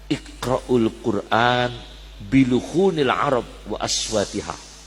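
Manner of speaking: slow, measured Arabic quotation; slow hadith recitation. Acted. Preacher recites the Prophet's words to the congregation, slow and measured. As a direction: draw out each Arabic word like a recited text.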